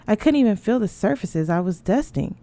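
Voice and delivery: ordinary delivery, nothing marked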